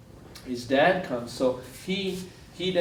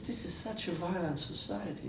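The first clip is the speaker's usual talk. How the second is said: imitating broken English; 5 semitones higher, 8 dB quieter than usual. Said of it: "he says, in broken English".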